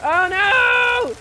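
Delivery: high, loud panicked cry. Real, not acted. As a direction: cry out in fear. Monster appears in game.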